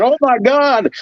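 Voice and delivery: high-pitched, excited exclamation at normal volume; retold excitement. Telling friends about running out after hearing a voice, excited. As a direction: re-enact your own thrilled reaction, pitch jumping up. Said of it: "I was all excited".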